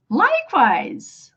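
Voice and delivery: drawn out, high then falling; slow repeat-after-me delivery; slow model word. Shown as a demonstration. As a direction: stretch it slowly for learners to repeat. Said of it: "repeat after me".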